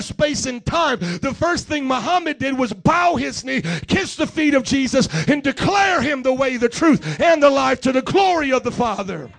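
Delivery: loud, driving sermon cadence, building as the list goes on; full-force preaching. Acted. Preacher declaring Jesus the only reality, full-force preaching. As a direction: hammer the names home to the congregation.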